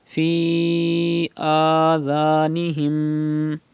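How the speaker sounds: Fi adhanihim very slow, heavy and stretched; slow drawn recitation. Shown as a demonstration. Teacher recites slowly to show the long madd. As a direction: draw it out, thick and slow. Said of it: "read sad with thicker voice".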